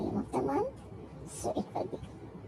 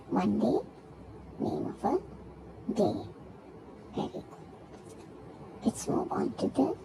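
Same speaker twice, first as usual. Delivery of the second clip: normal talking voice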